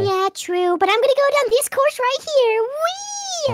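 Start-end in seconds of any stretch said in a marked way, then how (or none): 2.6-3.5 high, soft, drawn-out glee; playful whee. Pretend. Player zooming down the course, playful whee.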